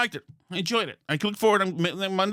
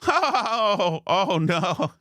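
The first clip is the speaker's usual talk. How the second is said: giggly high mock dismay; laughing oh no; 6 semitones higher, much slower than usual. Imitating the dentist giggling at the heart-stopping story, higher. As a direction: squeak it out half laughing. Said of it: "and she's laughing".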